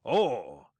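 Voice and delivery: a sudden loud exclamation; loud startled oh. Acted. Silver startled by the squire's letter, loud. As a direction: burst out with a start. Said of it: "quite loud".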